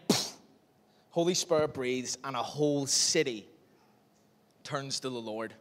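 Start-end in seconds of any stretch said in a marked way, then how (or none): none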